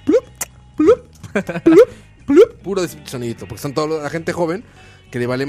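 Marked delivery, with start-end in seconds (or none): 0.0-2.5 high popping pings, loud; imitated WhatsApp pings. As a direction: pop out each plup like a phone alert. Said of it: "puro sonido de WhatsApp".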